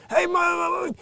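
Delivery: high stuttering sitcom call; Billy Gardell impression. Imitating the comedian grabbing the mic, high stutter. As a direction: fumble the name, big and high. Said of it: "He grabbed the microphone".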